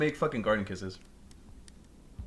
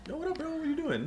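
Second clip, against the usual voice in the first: high imitated friend; 7 dB quieter than usual.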